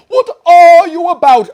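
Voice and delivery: very loud, high shocked shout; alarmed cry. Acted. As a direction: shriek at her in alarm. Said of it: "cried a dozen voices".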